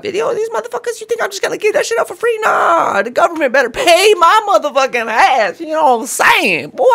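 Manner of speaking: high-pitched, loud, fast-building outburst; indignant mock rant. Man imagining holding UFO footage demands government pay, indignant rant.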